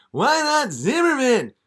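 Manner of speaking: high, loud yelling [name]; hammed-up high [name] shout; whiny [name]. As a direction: shout it like an eager young [name]. [name] asks.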